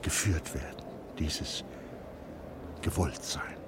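hushed, unvoiced, savouring each word; whispered narration. A narrator's reading. Narrator whispering what Christine feels, letting herself be led. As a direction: let the words dissolve into breath. Inner feeling, eyes closed.

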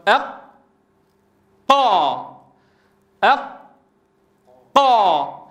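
أق held shut, then قا released hard after a silence; doubled qaf drill. Shown as a demonstration. Teacher showing the closed point, split أق ... قا with held ق. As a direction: seal the ق in أق, then burst into قا without breath. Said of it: "انظر كيف انغلق المخرج".

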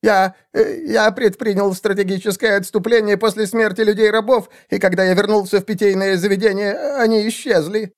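very high, squeaky self-justification; cowering excuse. A narrator's reading. Assassin excuses his retreat to seer, cowering. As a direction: explain yourself in a cringing high voice.

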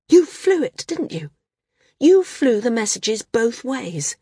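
high, loud accusation; accusing. A narrator's reading. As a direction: nail him with the truth. Maris accuses Arak.

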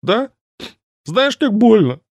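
high whiny sniffling complaint; whimpering and sniffling. A narrator's reading. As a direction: whine it through a runny nose. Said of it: "захныкала и проговорила, шмыгая носом".

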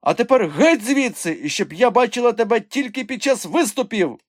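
high, loud angry order; furious dismissal. A narrator's reading. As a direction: throw him out with a high shout. Madam throws the dwarf out. (гнівний наказ забиратися геть)

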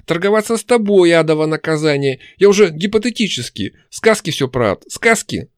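high, exasperated shouting. A narrator's reading. Demon fed up with bargaining, shouting. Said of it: "снова перешла на крик".

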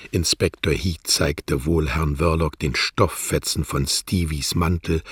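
ordinary delivery, nothing marked

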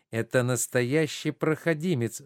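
ordinary talk

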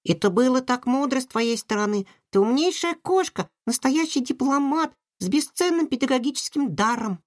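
high little mouse voice, adoring; adoring babble. A narrator's reading. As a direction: gush with admiration. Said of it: "с обожанием пролепетала Бим".

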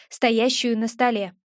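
plain reading voice

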